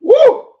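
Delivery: very high, loud whoop after a harsh sip; excited whoop at the strong bourbon. Real, not acted. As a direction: whoop as the burn hits. Reacting to neat high-proof sip.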